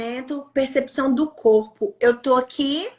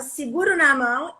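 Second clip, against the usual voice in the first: ordinary talk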